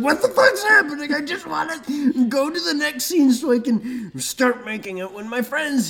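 high, frantic overwhelmed rant; exasperated. Retold or imitated. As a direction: sputter in frantic disbelief. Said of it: "You're like".

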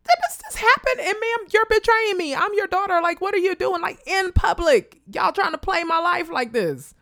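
very high, loud, betrayed disbelief; shocked disbelief. Retold or imitated. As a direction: squeal in outraged disbelief at your own mother. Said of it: "I'm sitting there like".

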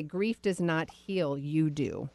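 drawn out slowly, each word weighed; slow quoted saying. Acted. Host quoting the guest's motto, slow and deliberate. Quoting a line she loves.

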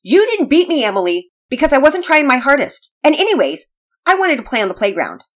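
high, very loud defiant shout. A narrator's reading. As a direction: shout it high so all the girls can hear. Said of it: "She shouted loudly so that all the girls could hear".

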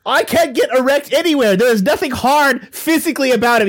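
high, hammed-up Irish-Canadian rant; outraged ghost Willie. Ghost stung by a player's jibe, mock-outraged. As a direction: get comically indignant in the mixed accent.

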